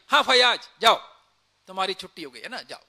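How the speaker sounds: loud, falsely friendly soldier's dismissal; a sly, loud pretend order to go; mock soldier's send-off. Imagined soldier dismissing Fayaz before shooting.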